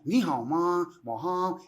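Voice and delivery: "Ni hao ma" with the pitch going up and down; rising and falling tones. Shown as a demonstration. Showing the host how Chinese sounds, tones rising and falling on "Ni hao ma". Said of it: "ele sobe e desce".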